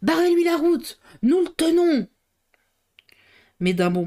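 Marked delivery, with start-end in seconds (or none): 0.0-2.1 high-pitched urgent cry; shouted order. A narrator's reading. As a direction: call out an alarm to the men.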